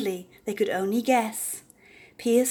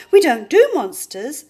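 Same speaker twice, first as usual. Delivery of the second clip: higher, louder squawky bird refusing; 7 semitones higher, 11 dB louder than usual. A narrator's reading. A bird refuses to fight the monster, squawky. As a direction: chirp it out like a fussy bird.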